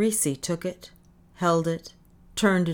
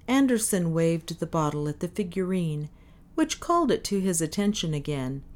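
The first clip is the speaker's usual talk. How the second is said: ordinary delivery, nothing marked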